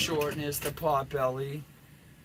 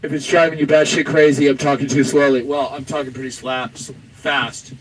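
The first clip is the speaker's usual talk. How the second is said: much louder, hammering every phrase; loud rant; 13 dB louder than usual. Acted. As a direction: blast it out like you're fed up. Rant about pace of talking.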